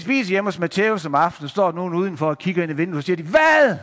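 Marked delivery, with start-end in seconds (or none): 3.2-3.8 loud, high, scandalised "what?"; high-pitched acted disbelief; shocked outburst. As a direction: exclaim it like an outraged onlooker. Neighbours shocked at whom Jesus visits.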